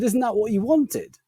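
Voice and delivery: high, slow questioning imitation; puzzled assistant. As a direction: lightly mimic a confused shop assistant. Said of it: "And she said".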